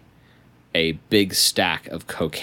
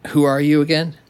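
boss snaps; 9 semitones higher, 5 dB louder, faster than usual. Acted.

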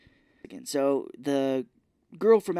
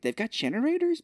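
high-pitched incredulous question; mock disbelief; 12 semitones higher than usual. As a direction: squeal it as an astonished question. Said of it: "And I'm like".

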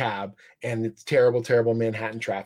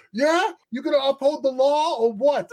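put-on New York accent; 12 semitones higher, 3 dB louder than usual. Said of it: "and the accent".

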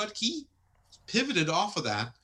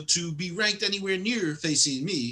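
mock Canadian accent, higher and louder; a hammed-up Canadian brag; 5 semitones higher, 5 dB louder than usual. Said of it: "he goes".

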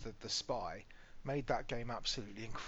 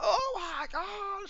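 much higher, louder, slow delighted squeal; imitating squealing daughter; 8 dB louder, slower than usual. Dad imitating his daughter's delight at the gift. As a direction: squeal with joy like a thrilled child. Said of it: "she was like".

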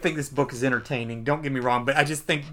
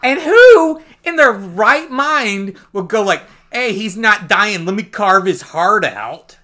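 higher, louder, slow mocking; mocking disbelief; 5 semitones higher, 10 dB louder, slower than usual. Real, not acted. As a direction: ridicule their dumb logic, drawn out. Mocking the soldiers' logic.

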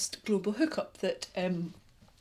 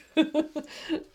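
high giggling laugh; giggle; 9 semitones higher than usual. Real, not acted. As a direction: burst into a giggle. Laughs at own giddiness.

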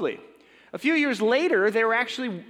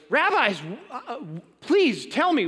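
higher, louder hurt demand; an offended host confronting the rabbis; wounded outrage; 6 semitones higher, 3 dB louder than usual. Acted. Insulted host pleads with rabbis.

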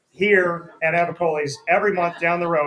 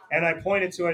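usual speaking voice

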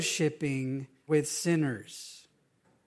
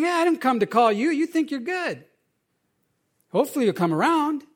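mock dismissive; 6 semitones higher, faster than usual. Voicing Jesus answering the Pharisees.

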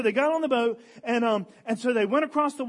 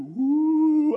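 mock spooky voice; 4 semitones higher, 6 dB louder than usual. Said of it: "made a spooky voice or something".

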